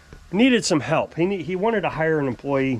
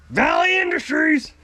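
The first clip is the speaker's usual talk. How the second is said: higher, slow, eager phone answer; excited phone greeting; 10 semitones higher than usual. Retold or imitated. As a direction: greet the caller like it's the best job ever. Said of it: "he'd answer the phone all excited".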